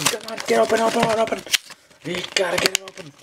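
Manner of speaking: high, quick coaxing; impatient pleading. Real, not acted. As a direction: plead with a stubborn wrapper in a high voice. Struggling to open the pack.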